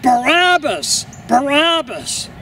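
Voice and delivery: high, drawn-falling chant of a crowd; crowd crying out. A narrator's reading. The crowd answering Pilate, crying out. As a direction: call the name out like a mob. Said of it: "now the crowd cried out".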